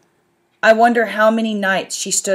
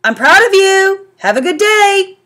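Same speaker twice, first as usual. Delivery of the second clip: proud dad; 12 semitones higher, 7 dB louder than usual. A narrator's reading. Dad to son.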